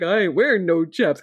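mock southern twang, put on; southern accent. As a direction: do a dopey southern cowboy accent. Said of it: "like, southern accent".

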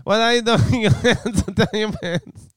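put-on high Borat accent breaking into laughter; Borat laughing. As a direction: giggle through the Borat line. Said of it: "I'm running as Borat".

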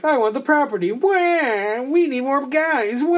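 high, whining villain tantrum; mocking a villain's high whine over the property; whiny villain. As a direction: whine like a spoiled bad guy. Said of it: "That was basically his character".

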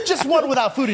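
high director voice, laughing through. Acted. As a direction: tease as a polite director.

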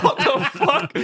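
high, loud incredulous squeal; laughing disbelief. Retold or imitated. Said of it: "It's like".

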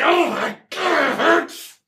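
high, slow hammed-up agony; monster in pain. Wounded monster wails at the hit, high mock agony. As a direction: groan it out in mock pain, drawn out. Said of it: "so he's like".

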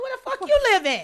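high, fast, outraged question; incredulous cashier. Retold or imitated. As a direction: blurt it out in disbelief. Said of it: "I'm like".

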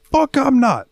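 high, drawn-out angry refusal; pissed-off customer. Retold or imitated. Angry customer refusing to wait for his beer, irate and high-pitched. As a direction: imitate an irate customer digging in. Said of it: "getting super pissed off".

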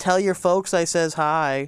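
put-on slow Wisconsin accent; mock Wisconsin accent. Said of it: "Wisconsin impressions".

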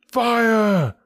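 high, drawn-out alarm cry; yelled cry of fire. A narrator's reading. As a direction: yell it out high and long. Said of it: "The shout must have rung".

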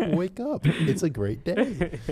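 high, slow, laughing cheer; cheery morning voice. Pretend. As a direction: sing it out like a cheesy morning host.

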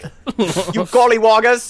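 slow, high British caricature; mock British accent. Mocking how the English sound, in a hammed-up British accent. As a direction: stretch it out in a silly British accent. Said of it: "exactly what you guys sound like".

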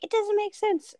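high-pitched mimicked kid protest; imitating daughter. Mom quoting her daughter arguing about zero, high mimicking voice. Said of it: "she's like".

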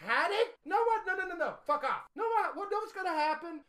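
high, incredulous venting; exasperated rant. Real, not acted. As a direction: fume in disbelief over the booking. Ranting at the wrestling result.

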